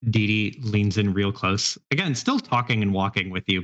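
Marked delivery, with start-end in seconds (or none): none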